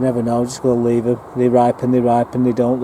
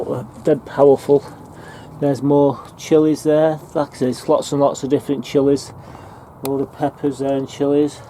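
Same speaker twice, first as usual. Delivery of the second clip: ordinary talk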